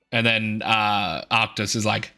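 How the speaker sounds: ordinary delivery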